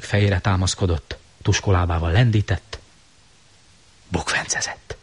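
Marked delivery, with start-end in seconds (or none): 4.1-5.0 unvoiced hushed word; whisper. A narrator's reading. Narrator whispering the monster's tumble. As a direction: whisper it softly.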